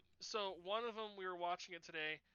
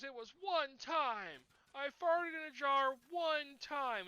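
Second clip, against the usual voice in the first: higher, louder and slow whining protest; whiny defensive kid; 8 semitones higher, 7 dB louder, slower than usual. Retold or imitated. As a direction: drag it out like an embarrassed kid pleading. Martin defending himself.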